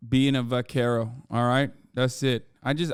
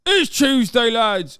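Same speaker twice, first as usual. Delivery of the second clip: much higher, louder cheer of Tuesday; high shouted hype; 9 semitones higher, 7 dB louder, slower than usual. Pretend. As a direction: belt it out to the lads. Repeated Tuesday bit.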